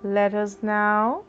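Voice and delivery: usual speaking voice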